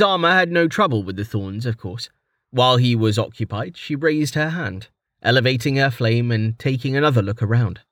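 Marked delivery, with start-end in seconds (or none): none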